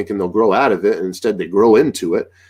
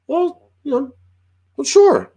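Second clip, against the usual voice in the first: high-pitched, put-on agreement; playfully grudging concession; mock conceding. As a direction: humour a doubter in a squeaky voice. Humouring people who doubt him.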